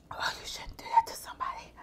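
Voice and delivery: breathless whispered gasp. Real, not acted. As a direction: whisper it, winded by the spice. Gasping through spicy noodles.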